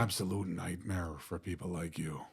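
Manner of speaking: low Northern Irish film tough guy; Liam Neeson. Shown as a demonstration. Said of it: "I can do Liam Neeson".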